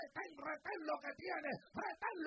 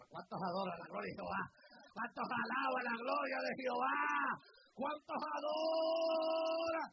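shouted call; 10 dB louder than usual. Real, not acted. Preacher calling the congregation to praise, loud shout. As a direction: call it out to the whole church.

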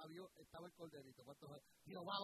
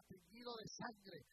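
usual speaking voice

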